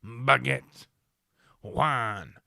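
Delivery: hammed-up French accent. Shown as a demonstration. Getting his French accent going, hammed-up French accent. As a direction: warm up a caricature French accent. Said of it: "I'll get my French accent going".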